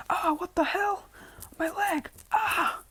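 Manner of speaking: very high, airy falsetto yelp; mock agony in a thin falsetto; pained cry. Villain shot in the leg, crying out in high-pitched pain.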